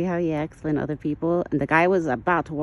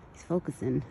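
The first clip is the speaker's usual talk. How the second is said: ordinary delivery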